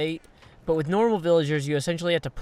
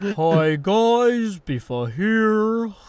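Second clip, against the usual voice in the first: higher, slow mock youtuber greeting, rounder mouthed; Biffa impression; 5 semitones higher, 5 dB louder, much slower than usual. Gamer friend doing his Biffa impression, hammed-up greeting. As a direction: round the lips and stretch out the hello. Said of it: "I've kinda got a Biffa one".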